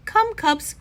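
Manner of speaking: high, loud call to the cubs; dad calling his cubs to come along; rallying call. A narrator's reading. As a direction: round up the cubs with gusto.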